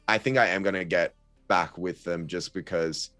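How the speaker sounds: ordinary delivery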